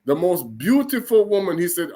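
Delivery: ordinary talk, nothing marked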